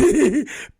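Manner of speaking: put-on high, mischievous child's giggle; imitated kid giggle. Dad mimics his kid's reaction, high giggle. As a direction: giggle like a naughty little kid.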